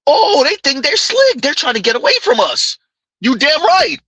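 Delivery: high, hammed-up indignant voice; mock outrage. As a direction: play it up as an outraged gossip. Voicing outraged women, mocking.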